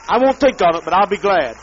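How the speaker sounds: loud, furious ranting; enraged yelling; angry shout. Real, not acted. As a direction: bellow the insults at them. Ranting curses at enemies.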